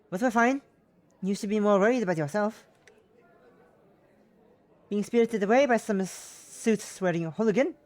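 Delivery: loud, indignant mother on the phone; ranting mother. Acted. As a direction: rant like an exasperated mum. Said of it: "As I hear my mother continue to rant".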